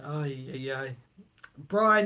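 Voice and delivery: usual speaking voice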